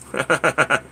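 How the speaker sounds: short chuckle; real, quick staccato chuckle; laugh. Real, not acted. Laughing at his own attempt at a word, short chuckle.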